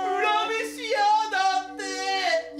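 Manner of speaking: high, loud, panicked shriek; terrified shriek. Acted. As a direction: shriek it in sheer fright. Scared man shrieks in terror.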